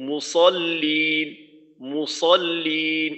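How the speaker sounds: মুসল্লিন with a thick s and long final lin; মুসল্লিন slowly, held l and long ee; heavy sad. Shown as a demonstration. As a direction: draw out মুসল্লিন, heavy s without a w glide. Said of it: "সটা এক তো হলো মোটা করা".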